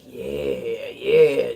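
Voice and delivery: very low, slow drawn-out yeah; deep seductive voice. Acted. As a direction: drop right down into a deep, smooth voice. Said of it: "You, you've got that deep".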